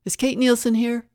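high, loud demanding question; loud, insistent demand. A narrator's reading. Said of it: "a loud, insistent voice demanded".

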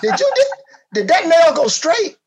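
high, loud, slow, laughing; fake-marvel while laughing; laughing mock praise.

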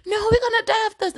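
put-on high, fast, overdramatic child whine; dramatic little kids. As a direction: wail it like a kid in crisis. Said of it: "they're like".

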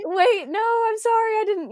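high, hurried-to-apologize retelling voice; flustered apology. Retold or imitated. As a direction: backtrack in a high, mock-panicked voice. Acting out own flustered apology.

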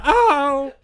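high, loud imitated yelp; mock cry of pain. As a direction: cry out as if just hit, mocking. Acts her cry when hit.

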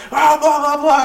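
high-pitched mock babble of rage; retold blustering. Boss ranting back at worker, high mock bluster. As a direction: imitate angry bluster in a high voice.

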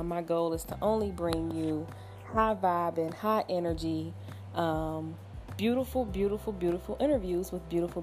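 usual speaking voice

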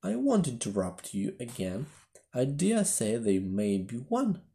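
usual speaking voice

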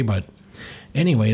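ordinary delivery

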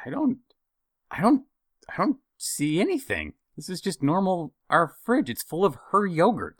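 confused mock whine; baffled. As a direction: squint into the fridge, lost. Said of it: "I'm like".

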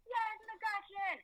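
high, slow stretched cheer for the home run. Real, not acted. Fan at Yankee Stadium cheering McCutchen's home run, high drawn-out cheer. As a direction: belt it out like a fan in the stands.

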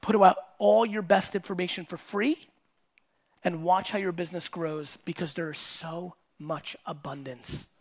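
slow, deliberate, spaced-out delivery; nice and slow. Shown as a demonstration. Speaker repeating advice for people in the back, nice and slow. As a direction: spell it out slowly, pausing between phrases. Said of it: "Let me say it nice and slow for the people in the back".

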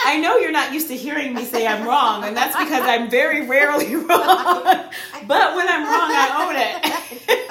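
loud, laughing, proud declaration. Retold or imitated. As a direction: brag it through laughter. Retells her proud reply to her fiance.

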